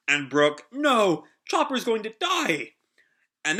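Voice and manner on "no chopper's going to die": high mock-anguished outcry; hammed-up dismay, pitched well up; horrified. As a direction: play a horrified friend crying out. Acting Brook's horror for Chopper.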